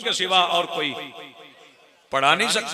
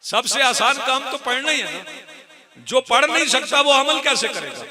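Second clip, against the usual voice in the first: high, insistent sermon voice, building louder; preacher's raised, urgent challenge; impassioned preaching; 6 semitones higher, 6 dB louder than usual. Real, not acted. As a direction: press the challenge on the congregation. Preaches at full force.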